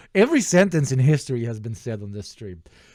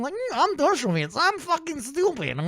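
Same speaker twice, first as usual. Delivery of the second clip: hammed-up nerd whine, very high-pitched; Dershowitz. Streamer making fun of the lawyer, nerdy mocking voice. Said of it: "he sounds like a nerd-ass bitch boy".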